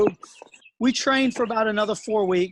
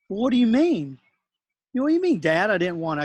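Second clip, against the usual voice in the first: higher, louder voiced twelve-year-old asking back; puzzled son; 3 semitones higher, 3 dB louder than usual. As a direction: lightly play the confused boy, pitch up. Dad voicing his puzzled son.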